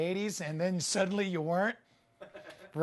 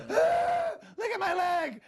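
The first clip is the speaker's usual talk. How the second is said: a gasp, then a much higher, louder, slow cry; horrified at the sight of his own leg; gasping shock; 4 dB louder, much slower than usual. Real, not acted. Man seeing his swollen leg cries out in shock.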